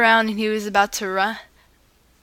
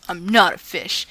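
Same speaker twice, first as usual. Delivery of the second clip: grumbled; 2 semitones lower than usual. Acted. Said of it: "crossed his arms and grumbled".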